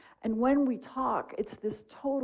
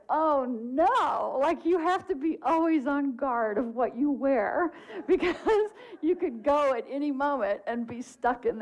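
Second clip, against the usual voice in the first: higher, comically alarmed retelling; laughing mock dismay; 5 semitones higher than usual. Two friends watching an afterlife movie, laughing in mock dismay. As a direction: wail it in mock horror, breaking into laughter. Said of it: "that moment of laughter".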